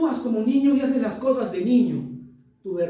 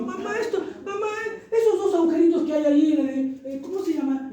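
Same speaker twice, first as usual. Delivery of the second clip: put-on child voice; 6 semitones higher than usual. Child asking mother about socket.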